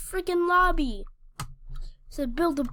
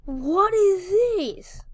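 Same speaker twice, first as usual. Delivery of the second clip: mocking; 6 semitones higher, 4 dB louder, much slower than usual. Real, not acted. Mocking Santa's outfit.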